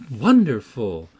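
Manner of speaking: a teasing burst of praise; exclaimed. A narrator's reading. Catherine mockingly praises Hareton, loud exclamation. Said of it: "I exclaimed".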